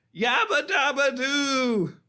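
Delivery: high, drawn-out cartoon holler; imitated shout. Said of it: "he gets to shout".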